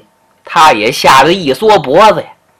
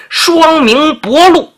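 伯鹿 said slow and high, syllable by syllable; spelling out his name; 11 semitones higher, slower than usual. Acted. Changbolu introducing himself to the big brother, slow and high on 伯鹿. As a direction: lift and stretch 伯鹿 so the name is clear.

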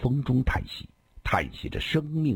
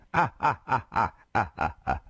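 staccato laugh, falling in pitch and fading; acted hearty laugh; 3 semitones higher than usual. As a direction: laugh out in a descending run. Character laughs after the girls' answer. (哈哈大笑，渐渐收住)

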